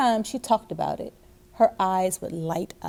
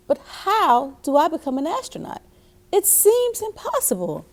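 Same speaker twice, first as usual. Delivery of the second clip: put-on worried little girl; 7 semitones higher than usual. A narrator's reading. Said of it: "she asked her dad".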